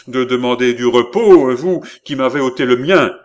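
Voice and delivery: loud, high-pitched angry reproach; outraged cry, pitch and volume up; indignant exclamation. A narrator's reading. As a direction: throw the accusation out loudly. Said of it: "s'écria Philippe".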